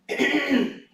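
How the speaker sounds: startling amplified throat clear, imitating; loud throat clearing. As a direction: blast a mock throat clear like it is miked. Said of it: "all of a sudden he'd go".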